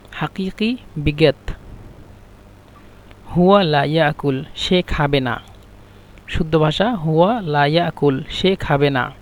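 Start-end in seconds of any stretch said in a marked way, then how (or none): none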